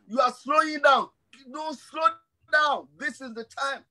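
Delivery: high-pitched preacher's exhortation; urgent preaching. Real, not acted. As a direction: urge the congregation on, pitch lifted. Exhorts congregation at raised pitch.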